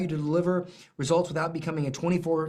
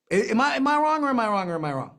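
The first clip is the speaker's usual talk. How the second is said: playful challenge; 3 semitones higher, much faster than usual. Real, not acted. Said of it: "rhetorical challenge".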